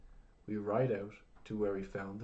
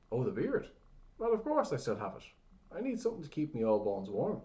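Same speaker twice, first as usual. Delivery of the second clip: put-on old man; 5 semitones higher, 7 dB louder than usual. A narrator's reading. Crazy old man voiced, high.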